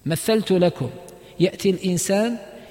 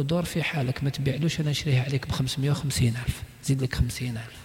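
quiet aside to the seller; 7 semitones lower, 8 dB quieter, much faster than usual. Retold or imitated. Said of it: "يتكلم مع البائع في أذنه".

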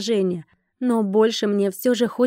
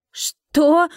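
high, loud cry of disbelief; shocked exclamation; 7 semitones higher, 5 dB louder than usual. A narrator's reading. Darina backs away from the dragon, exclaiming in shock. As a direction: jump up in pitch, startled. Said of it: "воскликнула Дарина".

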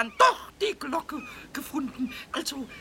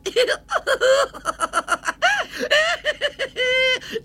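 cackling laugh; 8 semitones higher, 4 dB louder than usual. Acted. Pumuckl laughing loudly.

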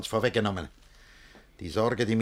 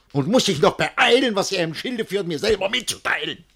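higher, louder bitter indignation; indignant outburst; 9 semitones higher, 6 dB louder than usual. Acted. Guardian alone, raging at the foolish suitor, higher and louder. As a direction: spit out the outrage.

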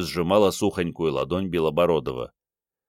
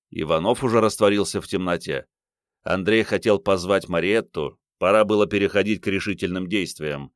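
ordinary delivery

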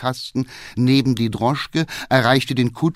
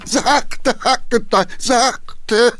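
high, loud cry of alarm; alarmed shout; 12 semitones higher, 4 dB louder, much slower than usual. A narrator's reading. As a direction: yell to the driver to ease off. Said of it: "schrie der Geheimrat". (erschrocken schreiend)

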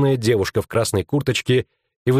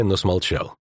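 usual speaking voice

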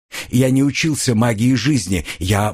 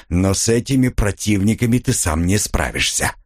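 plain reading voice

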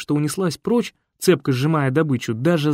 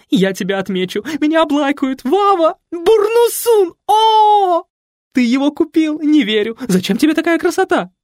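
high, loud, giddy chatter; gushing society mother; 6 dB louder than usual. A narrator's reading. Nikoletta gushing while taking selfies with Fred. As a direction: squeal and fuss like a starstruck diva.

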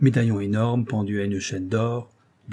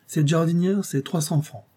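higher-pitched auctioneer's pitch; 5 semitones higher, 5 dB louder than usual. A narrator's reading. Grandelu quotes prices to André, in an auctioneer's tone. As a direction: call the price like selling a lot. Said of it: "d'un ton de commissaire-priseur".

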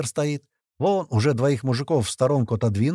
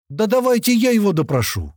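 much higher, louder, gleeful; cheerful eager offer; 10 semitones higher, 6 dB louder than usual. A narrator's reading. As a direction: offer to interrogate with relish. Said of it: "весело произнёс Клёпа". (весело и задорно, высоко и громко)

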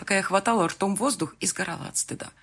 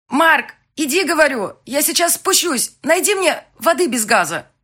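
much higher and louder; raised voice; 11 semitones higher, 11 dB louder than usual. Acted. Bride loudly sending fiancé off through the door. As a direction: call him away, strained. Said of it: "повысила голос".

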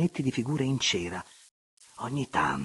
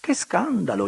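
high, loud prim gasp of outrage; mock horror; 10 semitones higher, 6 dB louder than usual. A narrator's reading. The baroness pretending to be shocked at the table, mock horror. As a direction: feign shock with a little grimace. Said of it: "con una smorfietta d'orrore".

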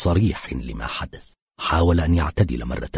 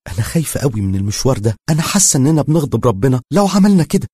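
fast, higher, louder worried plea; anxious; 8 semitones higher, 5 dB louder, faster than usual. A narrator's reading. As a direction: rush it out, frightened. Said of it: "قالتها باضطراب". (بقلق وسرعة وصوت أعلى)

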